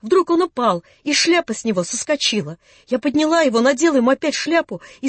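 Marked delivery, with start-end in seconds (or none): none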